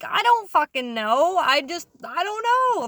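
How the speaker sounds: re-enacted exasperation, stretched out and high; flustered protest. New worker answers scolding boss on first day, flustered. Retold argument back at boss.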